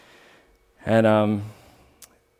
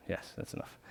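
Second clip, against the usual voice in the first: breathy whisper to himself, barely voiced; whispered aside; 18 dB quieter than usual. Real, not acted. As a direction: mutter it under your breath as a self-reminder. Aside to himself, time running short.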